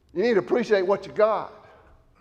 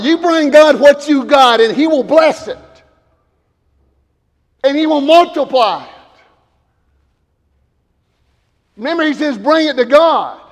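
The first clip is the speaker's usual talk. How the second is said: preaching with force; 6 semitones higher, 7 dB louder than usual. Acted. Preacher builds again.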